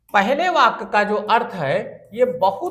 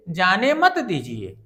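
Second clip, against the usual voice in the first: ordinary talk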